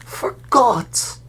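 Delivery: high loud aghast cry. Acted. Stan stunned that Harry forgot, high loud. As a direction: gasp it in disbelief. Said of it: "said Stan weakly".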